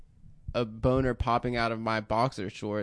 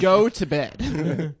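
slow, raised mock-parent voice; parent's bedtime order; 4 semitones higher, 4 dB louder, much slower than usual. Parent sending the kids off, drawn-out mock order. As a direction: draw out the bedtime command.

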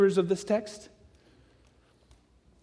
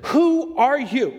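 slow pressing question; 6 semitones higher, much slower than usual. Retold or imitated. Priests pressing John in the desert, slow and insistent. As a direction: lean on each word like a demanding crowd. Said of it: "They said".

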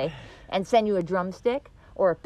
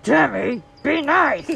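"Tammy, be nice" said louder and sharper, "nice" pitched high; stern self-warning; 4 semitones higher, 7 dB louder, much slower than usual. Shown as a demonstration. Warning herself to behave, louder and stern. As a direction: bark "Tammy, be nice" at yourself firmly. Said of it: "Sometimes I have to say it like".

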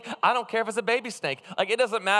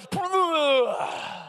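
sudden imitated vomiting sound; retching noise; 9 semitones higher than usual. Retelling throwing up in church.

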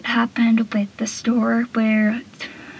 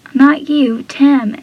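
retold outburst; 4 semitones higher, 7 dB louder, slower than usual. Andy yells at unseen Tim.

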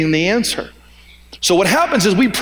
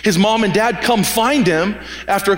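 ordinary talk, nothing marked

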